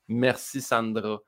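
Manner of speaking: slow, soft Québécois Merci Sandra; Québécois accent. Retold or imitated. Parting shot to the director, soft slow Québécois accent. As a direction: say Merci Sandra slowly and quietly in a Saint-Hyacinthe Québécois accent. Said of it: "en français".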